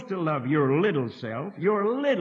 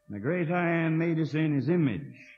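normal talking voice

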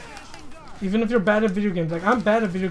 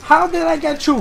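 higher and louder squeal of disbelief; excited repeat; 7 semitones higher, 8 dB louder than usual. Real, not acted. Gamer repeating his amazement at the opponent, giddy and loud. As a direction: push it up into a giddy yelp.

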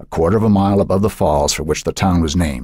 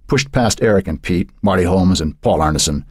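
usual speaking voice

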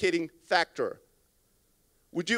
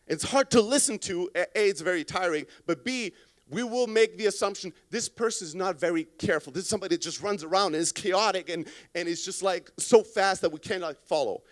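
usual speaking voice

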